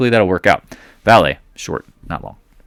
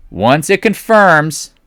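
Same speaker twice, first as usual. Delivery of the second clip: "confirms" drawn out long and high; very stretched "confirms"; emphatic repeat; 8 semitones higher, 4 dB louder, much slower than usual. Shown as a demonstration. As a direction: hammer the rule with a slow, high "confirms".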